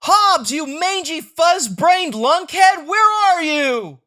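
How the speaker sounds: loud, high furious shout into the dark; angry yelling. Acted. Child scolding his lost tiger into the woods, loud angry yell.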